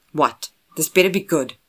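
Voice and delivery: loud, annoyed phone answer; irritated. Acted. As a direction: answer curtly, annoyed at being interrupted. Irritated answer to interrupting call.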